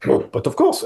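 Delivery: put-on French accent; mock audition French accent. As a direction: lay on the French accent obligingly. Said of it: "Can you make it more French".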